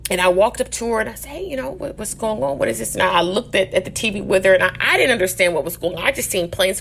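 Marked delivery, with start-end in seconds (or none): 1.3-2.9 softer, higher schoolkid's query; hushed, questioning re-enactment; tentative retold question. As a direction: ask it quietly, lightly re-enacting a puzzled new kid. Retelling her timid question to teacher.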